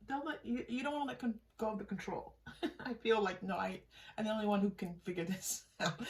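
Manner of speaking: ordinary talk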